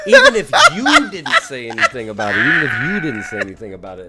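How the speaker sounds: high, loud laughing protest. Real, not acted. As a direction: talk through real cracking-up laughter. Cracking up at anime topic.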